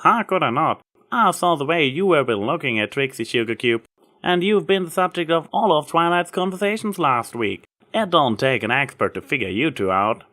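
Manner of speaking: a hint of a country farm mare, kindly; lightly voiced Applejack. A narrator's reading. Applejack brushing off Trixie's apology, lightly voiced country mare.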